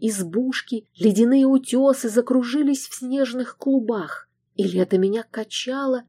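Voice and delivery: usual speaking voice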